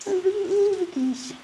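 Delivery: high soft call to the cat. Real, not acted. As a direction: softly coax the kitty over. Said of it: "me calling for the cat".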